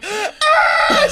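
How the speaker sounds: high hammed-up sobbing cry; imitated crying shriek; mock wail. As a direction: wail like someone holding a dying dog. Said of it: "the waterworks start".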